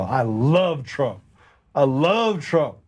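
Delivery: LOVE drawn way out; slow, stretched-out "love" with a big pitch swing; emphatic declaration. Retold or imitated. Stranger insisting to a doubter he's a Trump fan, LOVE stretched emphatically.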